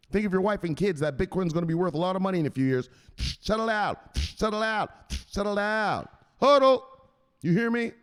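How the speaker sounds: high-pitched, hectoring telling-off; scolding enforcer. Acted. Enforcer lecturing a weak hand, high hectoring. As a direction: hector them, rising to a yelped command. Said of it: "you know what he tells them".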